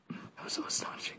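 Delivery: fast giggly whisper; whispered giggle; whispered laugh. Real, not acted. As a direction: breathe it out, still laughing. Whispering through leftover laughter.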